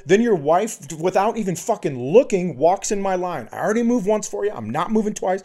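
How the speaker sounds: high-pitched furious rant; losing it. Retold or imitated. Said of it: "now I'm losing my shit".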